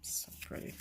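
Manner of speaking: whispered, barely there; whispering. Real, not acted. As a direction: breathe it, almost silent. Breathed aside.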